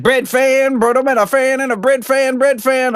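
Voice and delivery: high sing-song mimicry of a rock singer; [name] impression. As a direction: imitate the band's high squawky hook. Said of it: "that's my [name] impression".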